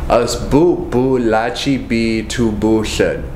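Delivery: ordinary talk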